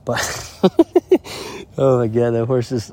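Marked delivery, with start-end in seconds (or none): 0.2-1.7 high, breathy burst of laughter; startled laugh, mostly air. Real, not acted. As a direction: let out a real high giggle.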